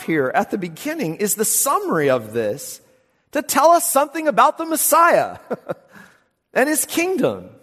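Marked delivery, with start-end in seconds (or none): 3.3-7.5 laughing preacher. Real, not acted.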